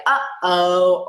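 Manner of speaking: a loud, playful uh-oh; mock dismay for a toddler; uh-oh exclamation. Shown as a demonstration. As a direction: say it like something just spilled. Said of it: "So things like".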